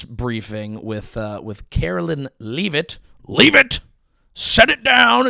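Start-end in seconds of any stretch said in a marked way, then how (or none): 3.3-5.3 high, sharp dog command; yelling at a dog. Acted. Comic turns the surname into a dog command, yelling. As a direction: shout it like scolding a disobedient dog. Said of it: "It sounds like you're just yelling at a dog".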